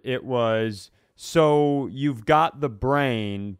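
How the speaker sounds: ordinary talk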